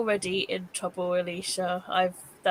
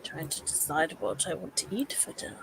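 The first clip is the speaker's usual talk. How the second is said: whispering; 5 semitones lower, 10 dB quieter than usual. Real, not acted. Unvoiced low line.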